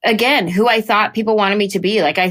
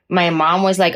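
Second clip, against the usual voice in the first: ordinary delivery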